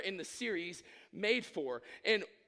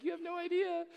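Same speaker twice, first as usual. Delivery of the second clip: mock squealed reply, far above usual pitch; high-pitched retort; 12 semitones higher than usual. Proposer answers the cooing crowd, in a mock high squeal.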